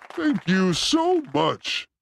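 drawn-out, very slow thank you; lingering grateful thank you so much. Real, not acted. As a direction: stretch every word of the thanks. Thanking the viewers.

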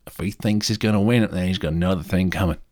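usual speaking voice

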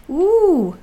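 high, loud startled "ooh"; sudden exclamation. A narrator's reading. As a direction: burst out in sudden surprise. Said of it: "said Susan suddenly".

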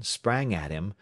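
ordinary delivery

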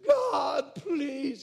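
loud begging shout of God; desperate cry. Retold or imitated. Preacher reenacting his childhood altar prayers, shouting. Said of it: "as a kid, come up to the altar".

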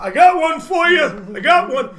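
put-on loud, high old-timer's call; eager old man. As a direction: imitate an old man shouting his offer. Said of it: "old men will come by".

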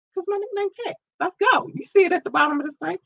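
thrilled at the coincidence; giddy squeal. Real, not acted. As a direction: squeal over your own nickname. Thrilled her nickname is in it.

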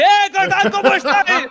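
saludo muy agudo y más fuerte, medio riendo; padre entrando alegre. Retold or imitated. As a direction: imita a un padre despistado que entra saludando. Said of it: "y dice". (padre que saluda alegre, muy agudo, entre risas)

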